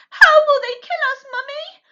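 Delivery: high, loud scared small fox; frightened child. A narrator's reading. Small fox asking his mother about death, frightened. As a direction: ask it wide-eyed with fright. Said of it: "huge with fright".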